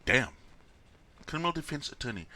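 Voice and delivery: usual speaking voice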